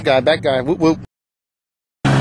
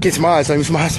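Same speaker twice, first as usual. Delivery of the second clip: ordinary talk, nothing marked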